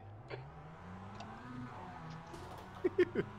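soft, drawn-out laughing fit; real laugh. Real, not acted. Racer cracks up after the green flag, real laugh. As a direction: laugh quietly and helplessly for several seconds.

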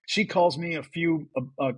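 ordinary talk, nothing marked

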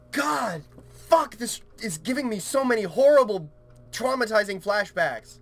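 high-pitched, frustrated venting; exasperated rant. Real, not acted. Player reliving horrible flashbacks from the game, exasperated rant. Ranting after being interrupted.

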